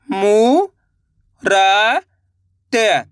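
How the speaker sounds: मू रा त spoken one by one with long gaps; separate syllables. Shown as a demonstration. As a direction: sound out each syllable slowly. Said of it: "चलिए देखिए".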